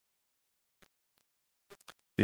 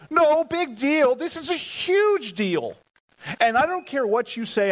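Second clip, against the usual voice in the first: that was anything but small; incredulous; 12 semitones higher, 4 dB louder than usual. Real, not acted. Preacher insisting to the congregation the moment was huge, high and incredulous.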